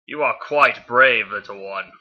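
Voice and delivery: slow villain voice, loud start tailing off; a weak attempt at a menacing robot warlord; Megatron. Reader as the villain addressing Bee, slow and measured. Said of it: "not a really good Megatron impersonation".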